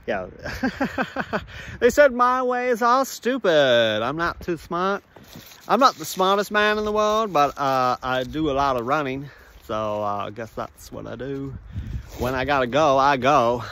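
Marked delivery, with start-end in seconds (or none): 1.8-13.6 simple-minded movie character. Acted. Movie runner explaining what he does, simple-minded voice.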